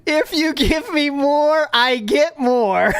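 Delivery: slow, high, teasing sing-song; drawn-out and sly, rubbing it in; sing-song pitch. Shown as a demonstration. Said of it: "Get it?".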